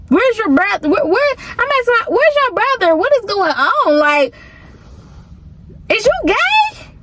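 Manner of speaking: high, disbelieving questions; high incredulous demand. Retold or imitated. Disbelieving cousin confronting the man in pink panties. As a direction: demand answers in shock.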